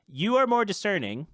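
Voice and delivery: ordinary delivery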